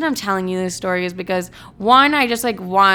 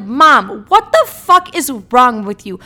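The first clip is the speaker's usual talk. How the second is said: loud, raised, slow exasperated demand; retold outrage; 4 semitones higher, 7 dB louder, slower than usual. Daughter to mom about weight loss app, retold outrage. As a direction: punch it out slowly, incredulous and loud.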